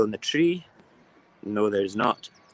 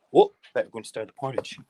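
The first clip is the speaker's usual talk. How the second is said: ordinary delivery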